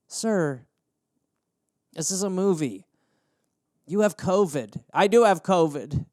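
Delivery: a hint of a calm employee; mock stern staff. Disney staff confronting guest.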